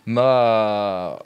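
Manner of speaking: ordinary talk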